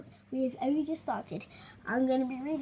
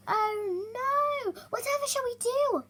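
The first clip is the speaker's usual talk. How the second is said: wailing; 12 semitones higher, 4 dB louder than usual. Acted. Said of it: "wailed Vole".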